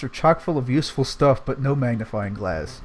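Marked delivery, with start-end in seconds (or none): none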